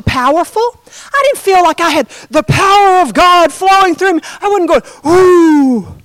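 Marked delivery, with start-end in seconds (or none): 5.1-6.0 loud, mock "ooh" blown out; mock blowing. Preacher mocking blowing power onto someone, loud whoosh. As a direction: blow out a showy, exaggerated whoosh. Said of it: "blow on him like Benny Hinn".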